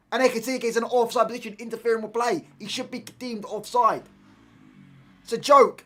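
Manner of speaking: loud, high, shouted football fury; yelling at the screen about the offside call; furious shouting rant. Real, not acted. Fan screaming at VAR over the offside goal, furious shout.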